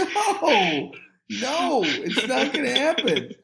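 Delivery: high, insistent string of noes; emphatic refusal. Retold or imitated. Storyteller refusing the kid's proposition, high emphatic no.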